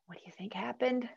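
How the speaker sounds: hushed whisper of suspense. A narrator's reading. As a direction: whisper it like a secret. Suspense question to child listeners.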